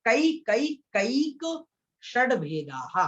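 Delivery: slow, separated "कई, कई, कैक", then drawn-out "षड भेदाः"; careful threefold repetition. Shown as a demonstration. Teacher showing that कई comes three times, slowly separated. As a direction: set each "कई" apart distinctly. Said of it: "तीन बार कई है".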